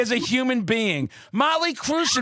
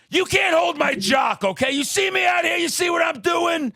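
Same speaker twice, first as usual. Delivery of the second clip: brash challenge; 9 semitones higher than usual. Retold or imitated. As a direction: call out the crowd like a strutting frontman. Acting out a boastful stage challenge.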